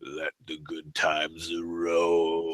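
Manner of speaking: very slow, low and hushed in-character voice; Undertaker. Acted. Wrestler in character at press conference, low slow intoning. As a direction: drag it out low and soft, holding "roll". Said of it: "In character".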